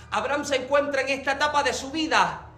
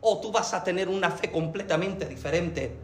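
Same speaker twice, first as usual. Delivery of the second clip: quiet reassurance; 5 semitones lower, 10 dB quieter than usual. Acted. God's reassurance continues.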